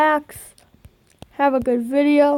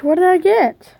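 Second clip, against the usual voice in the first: exasperated shout; 5 semitones higher, 6 dB louder than usual. Real, not acted. Frustrated at a pack reveal.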